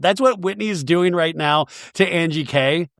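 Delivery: ordinary talk, nothing marked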